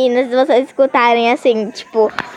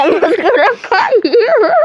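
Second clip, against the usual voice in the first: laughing; 8 semitones higher, slower than usual. Acted. Laughing dramatic confession.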